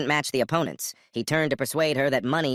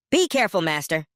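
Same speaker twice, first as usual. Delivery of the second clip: high, loud alarmed warning; worried cry; 6 semitones higher, 4 dB louder than usual. A narrator's reading. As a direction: shout a frightened warning to her master. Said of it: "Lily was worried".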